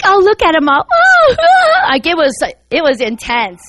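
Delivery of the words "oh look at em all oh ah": high-pitched mock wailing. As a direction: mimic them jeering at weeping worshippers. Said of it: "they began mocking us".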